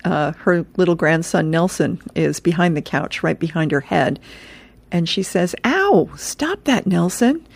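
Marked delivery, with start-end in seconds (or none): 5.7-7.5 high, drawn-out "ow" and scolding; pained grandma. Acted. Grandma scolding her grandson from the couch, high pained protest. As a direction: play a hurt grandma, pitched up and slow.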